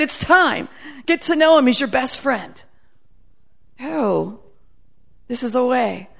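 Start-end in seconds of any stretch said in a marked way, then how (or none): none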